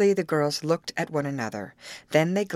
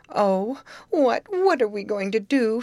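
raised, plaintive wail, lightly acted; a touch of tearful distress, pitched high; mild sob; 6 semitones higher, 4 dB louder than usual. A narrator's reading. Grace despairs to her friends, mildly sobbing. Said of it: "sobbed Grace".

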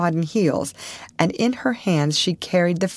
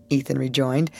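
usual speaking voice

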